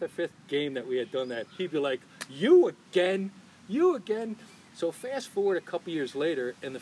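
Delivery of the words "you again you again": slow, loud, drawn-out disbelief; mock exasperation. Ballplayer spots his regular heckler, loud drawn-out exasperation. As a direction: stretch YOU high in weary recognition. Player fed up with repeated ribbing.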